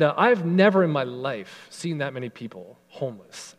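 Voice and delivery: ordinary talk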